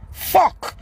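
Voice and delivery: ordinary delivery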